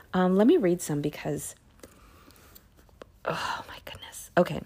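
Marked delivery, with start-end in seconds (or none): 3.2-4.3 whispered oh my goodness, unvoiced; breathless whispered awe; awed whisper. Real, not acted. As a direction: hush it in amazement.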